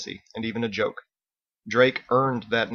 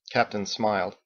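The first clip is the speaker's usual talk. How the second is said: plain reading voice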